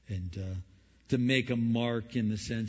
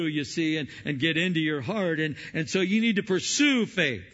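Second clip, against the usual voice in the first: louder, driving exhortation; fiery preaching; 4 semitones higher, 6 dB louder than usual. Acted. Preacher urges congregation to pursue faith, full-force preaching. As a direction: preach it hard to the pews.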